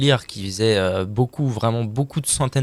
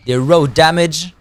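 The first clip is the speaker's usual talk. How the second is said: loud, slow, higher mock English accent; exaggerated English accent; 6 semitones higher, 9 dB louder, slower than usual. Shown as a demonstration. Streamer mocking own accent, overdone English accent. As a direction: ham up the English accent on "raw damage". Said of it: "mon accent, il est fabuleux".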